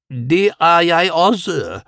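high, slow, drawn-out cat voice; meowing cat. A narrator's reading. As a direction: stretch the words into a meow. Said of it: "miavede Karajan". (langtrukkent miavende)

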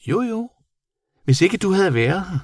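very high creature voice; insistent bat. A narrator's reading. Bat crediting the modest boy, high insistent voice.

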